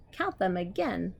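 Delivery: usual speaking voice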